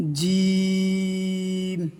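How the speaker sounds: জিম stretched very slowly with a hard sound; long chanted জিম; drawn-out jim. Shown as a demonstration. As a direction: hold জিম for four alif lengths. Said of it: "চার আলিফ টান দিয়ে পড়তে হবে".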